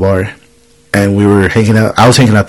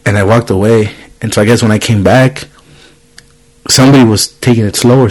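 ordinary talk

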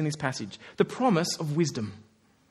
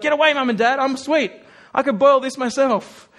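put-on higher, louder bratty little kid; stubborn toddler; 6 semitones higher, 6 dB louder than usual. Preacher voices a toddler shoving mum and dad away, high and loud. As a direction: push the parents away like a defiant three-year-old. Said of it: "a three-year-old kid at the stove".

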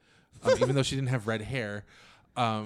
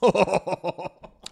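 amused laugh; 6 semitones higher, 7 dB louder than usual. Real, not acted. Laugh after quoting.

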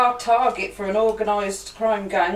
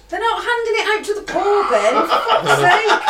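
loud; 10 semitones higher, 5 dB louder than usual. Real, not acted. Mock outrage at Ben.

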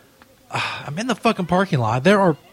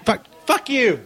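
yelling at a driver; 11 semitones higher, much slower than usual. Acted. Cursing out a driver.

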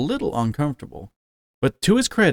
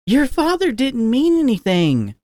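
much higher, soothing mom voice; smooth things over; placating mother; 12 semitones higher than usual. A narrator's reading. Mother urging her son to sit, high and placating.

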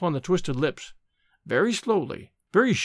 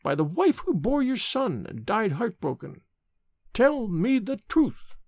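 high, drawn-out plea for the truth; solemn demand; 5 semitones higher than usual. A narrator's reading. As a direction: demand it as an oath, pitched up. Nurse demands a dying man's confession.

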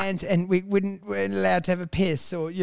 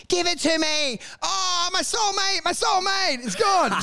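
much higher, wailing, slow on "soulmate"; mock melodramatic girl; 11 semitones higher than usual. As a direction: wail over the lost soulmate in a high mock voice. Mocking the girl's despair.

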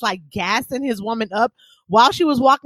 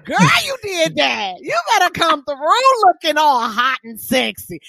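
put-on hype girl cheer; 8 semitones higher than usual. Hyping up a homegirl's outfit, high excited cheer. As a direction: cheer her on like her biggest fan. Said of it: "People, I'll be like".

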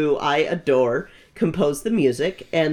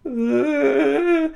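a high, strained anguished groan; groaned ugh; 12 semitones higher than usual. Retold or imitated. Friend reacting to news, high pained groan. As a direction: let out a high cry of dismay. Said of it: "me just going".

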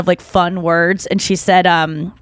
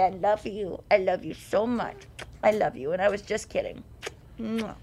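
very soft cooing; hushed, doting baby talk; cute pet voice; 13 dB quieter than usual. Acted. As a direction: murmur sweetly to a beloved dog. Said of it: "a cute voice".

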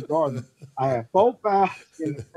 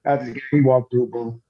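ordinary talk

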